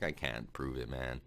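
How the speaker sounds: ordinary delivery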